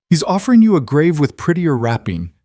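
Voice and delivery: a touch of strain, pushed up in pitch and volume; tight voice. A narrator's reading. Husband warns her about the caller's offer, tight voice. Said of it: "Lucien's voice was tight".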